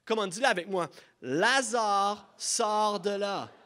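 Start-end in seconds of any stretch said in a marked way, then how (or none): 1.2-3.5 raised, drawn-out call; loud cry. Acted. Preacher leads the congregation in calling Lazare out, loudly. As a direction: call it out for a crowd to repeat. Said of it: "il cria d'une voix forte".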